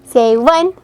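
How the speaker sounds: very high, loud squealing count; excited count. Real, not acted. Photographer counting for toddler, excited squeal.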